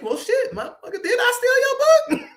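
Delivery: very high, squeaky and laughing; a shocked inner thought through laughter; laughing disbelief. Retold or imitated. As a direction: crack up in stunned disbelief. His stunned inner reaction.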